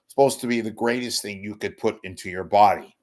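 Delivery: ordinary talk, nothing marked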